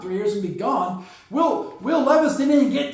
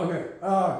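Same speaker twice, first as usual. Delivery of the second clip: ordinary talk, nothing marked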